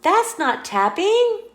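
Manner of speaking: high, loud little protest; a small squirrel, puzzled and loud; squirrel objecting. Acted. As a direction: point out the mistake, puzzled.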